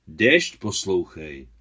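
slow, loud, weighty refusal; firm repetition. A narrator's reading. Adam brushes off the boy's pleading, slow firm repetition. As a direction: repeat it slowly and firmly. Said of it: "opakoval Adam".

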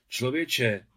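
loud call across the yard; calling from afar. Acted. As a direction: shout it out from a distance. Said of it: "křikl už z dálky profesor".

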